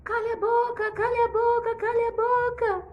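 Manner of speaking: the bird echoing the king; parrot shouting. Acted. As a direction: parrot yelling back the words. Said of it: "começou a gritar".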